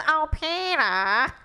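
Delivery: slow, pinched cartoon drawl; imitating Lois. Cohost mimicking her Lois voice, slow and pinched. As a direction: drag it out like Lois. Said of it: "you're like this".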